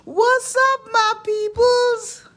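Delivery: high, slow, loud hello; excited greeting. Real, not acted. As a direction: sing out the greeting to viewers. Big vlog greeting.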